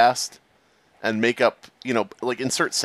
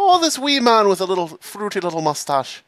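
mock Irish; 7 semitones higher than usual. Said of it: "That's what they would say".

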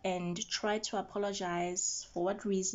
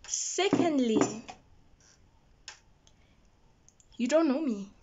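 indignant retort; 4 semitones higher, 5 dB louder than usual. Real, not acted. Telling the guy off for his insult, loud and indignant. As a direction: put him in his place, firm and loud.

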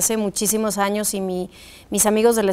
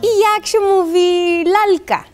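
put-on higher, lightly childlike sister's question in Polish; older sister asking; 7 semitones higher, slower than usual. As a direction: play the big sister quizzing her little sibling. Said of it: "me dice".

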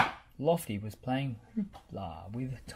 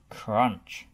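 ordinary delivery

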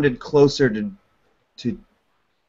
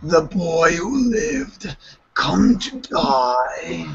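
a slow, lightly voiced dark lord; slow, cried-out villain line; Voldemort voice; 3 semitones higher, slower than usual. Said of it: "Sounds nothing like".

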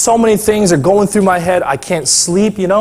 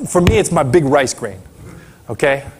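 ordinary delivery

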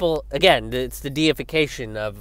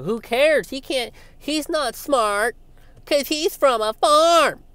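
much higher, slow condescending voice; mocking Claire's snobbery; 12 semitones higher, 4 dB louder, slower than usual. Mocking Claire dismissing Hawley as a farm boy, much higher and slow. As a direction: look down your nose at the farm boy. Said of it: "she kind of portrayed him as".

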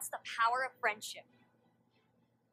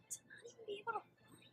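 stunned whisper; 9 semitones higher, 10 dB quieter than usual. Acted. Stunned character.

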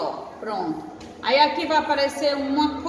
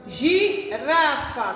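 slow sounding out; much slower than usual. Shown as a demonstration. Teacher to young pupils, sounding out slowly. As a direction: stretch each syllable of Girafa slowly. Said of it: "teacher sounds out word for kids".

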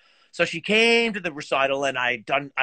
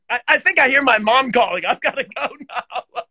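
higher, louder, laughing through a lame excuse; 6 semitones higher, 5 dB louder than usual. Retold or imitated. Musician dodging his girlfriend's critique, laughing. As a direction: flee the conversation while cracking up.

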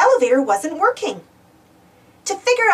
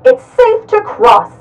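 loud announcement through a megaphone; 9 semitones higher, 11 dB louder than usual. Acted. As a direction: call out to the crowd by megaphone. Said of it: "Chase said in his megaphone".

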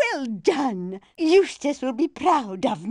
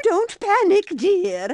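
usual speaking voice

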